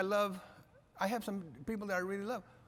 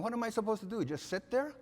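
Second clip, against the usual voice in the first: wife's excited retort; 7 semitones higher than usual. Retold or imitated. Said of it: "She said".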